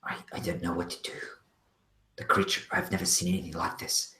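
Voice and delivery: soft, hushed and shaken; shaken whisper. Acted. Valky tells Urda of the killings, hushed and shaken. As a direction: whisper the horror you just saw. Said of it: "she says".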